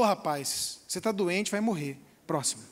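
low, blunt, offhand doctor's verdict. Retold or imitated. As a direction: toss the bad news off like a cold doctor. (médico seco e grave)

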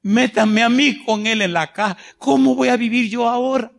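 lightly voiced grieving widow pleading; a hint of a widow clinging to the coffin; desperate widow. Preacher voicing a widow at her husband's coffin, lightly pleading. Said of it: "ella desesperada decía".

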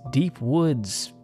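ordinary delivery, nothing marked

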